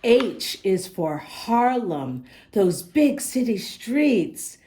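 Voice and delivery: slow, clear storybook reading; reading to children. Acted. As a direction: read the rhymes to little listeners. Reads the picture book aloud.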